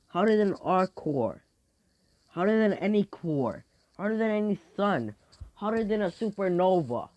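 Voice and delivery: high-pitched, insistent bragging; escalating boast. Acted. Fighter boasting about fire power, grandiose bragging. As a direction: top each boast with the next, pitched high.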